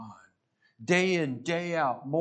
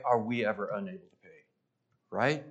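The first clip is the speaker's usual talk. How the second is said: ordinary delivery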